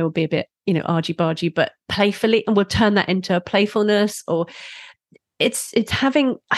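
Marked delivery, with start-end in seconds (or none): none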